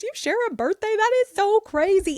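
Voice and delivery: put-on high, bright-eyed gushing to the kids; excited mom voice. Mom cheering her kids about their shared birthday, high and excited. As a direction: gush with delight like a thrilled mom. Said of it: "So I've always been like".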